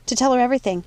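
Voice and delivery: ordinary delivery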